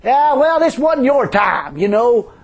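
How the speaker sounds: high, loud jeering tempter with chuckles; hammed-up gloating heckler; mocking spirit. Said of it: "say on the way back".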